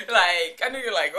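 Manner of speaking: ordinary talk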